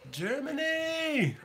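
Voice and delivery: long, high, stretched-out cheer of "Germany!"; a big delighted shout-out to the German viewers; excited drawn-out call. Real, not acted. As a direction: call out "Germany!" slowly, like hailing a friend across a room. Greeting German viewers in chat.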